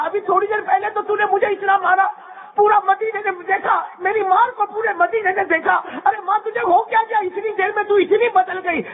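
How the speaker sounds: high, ringing sermon delivery; full-force preaching. Acted. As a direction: preach it out to the whole hall.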